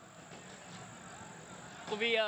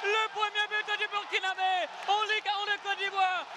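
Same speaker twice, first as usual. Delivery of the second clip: excited sports commentary; 3 semitones higher than usual. Real, not acted. Goal call by commentator.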